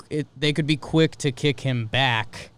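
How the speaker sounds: ordinary delivery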